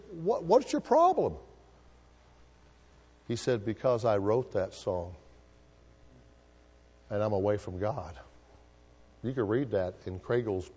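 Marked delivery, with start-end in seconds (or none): 0.1-1.4 high, slow and sharp; indignant retort. Retold or imitated. Lady on the stagecoach indignantly asks the man his problem. Lady snaps back at him. 3.6-8.2 sad confession. Retold or imitated. Hymn writer confessing to the lady, low and sad.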